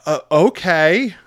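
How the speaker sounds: loud, slow, rising "okay"; dubious reaction. Acted. Crowd reacting to a misplaced movie quote, dubious.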